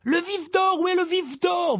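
high, frantic shouting; urgent high-pitched yell; bellowing. A narrator's reading. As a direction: demand it at the top of your voice. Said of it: "vociféra Charlie".

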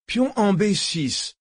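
high, slow, ringing call; announcing a chess move. Acted. Willy replying to his chess opponent, announcing a move. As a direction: declare the countermove, stretching each part.

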